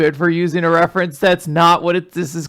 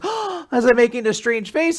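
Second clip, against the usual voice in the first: high, gasping mock surprise; imitated flustered question; startled; 6 semitones higher than usual. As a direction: act caught out with a little gasp. Lulu caught making a face.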